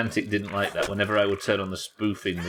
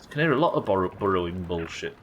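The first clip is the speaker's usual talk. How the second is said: ordinary talk, nothing marked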